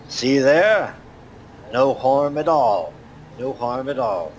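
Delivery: dwarf reassuring companions by the fire. Acted. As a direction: wave off their worries. Dwarf voice held.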